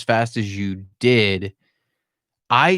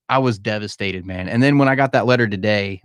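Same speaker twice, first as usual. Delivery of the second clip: ordinary talk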